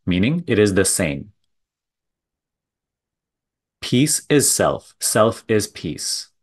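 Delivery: measured guiding delivery with long silences between phrases; contemplative spiritual teaching. Real, not acted. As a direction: let each phrase land, then leave a long pause. Leads a contemplative talk.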